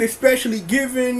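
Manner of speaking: ordinary delivery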